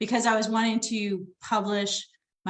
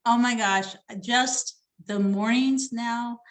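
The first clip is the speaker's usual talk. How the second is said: ordinary talk, nothing marked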